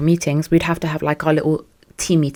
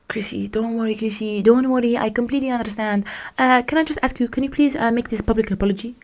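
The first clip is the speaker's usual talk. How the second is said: softer, raised, put-on placating voice; mock meek boss; 4 semitones higher, 5 dB quieter than usual. Boss asking a staffer for a public apology, mock meek voice. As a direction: imitate him softly, pleading and placating.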